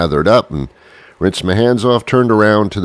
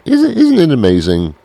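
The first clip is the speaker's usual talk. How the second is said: higher, pitched-up amazed "Isn't"; voice going up; 7 semitones higher than usual. Shown as a demonstration. Host demonstrating his voice rising, pitched up. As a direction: lift the voice on "Isn't, isn't". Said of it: "Listen to how my voice went up".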